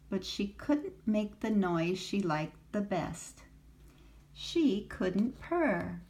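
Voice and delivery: usual speaking voice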